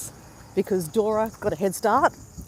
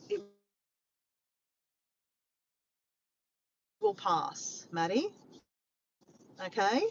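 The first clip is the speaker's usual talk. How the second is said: soft, slow and soothing; tender comfort; 7 dB quieter than usual. Real, not acted. Soothing a sick friend from the chat, softly. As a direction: gently reassure a friend who feels unwell.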